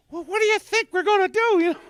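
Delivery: very high, fast puzzled squeak; squeaky high voice. Retold or imitated. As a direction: squeal it out in disbelief. Imitating puzzled self at Care Bears.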